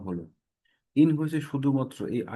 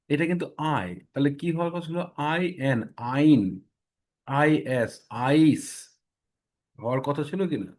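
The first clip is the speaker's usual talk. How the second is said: usual speaking voice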